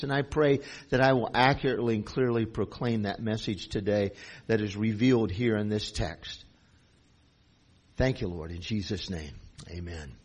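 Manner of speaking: low, soft prayer voice. Real, not acted. Pastor praying to the Lord before teaching, hushed and low.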